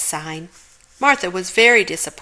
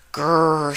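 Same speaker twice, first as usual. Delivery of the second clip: dog growl; 3 semitones lower than usual. Acted. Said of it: "said Martha".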